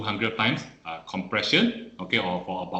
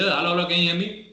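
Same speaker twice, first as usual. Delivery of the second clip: raised, quick call into one ear; demo shout; 6 semitones higher, faster than usual. Shown as a demonstration. Trainer shows shouting into the casualty's left ear, raised quick call. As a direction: shout close to the ear to check a response. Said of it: "you shout at this ear".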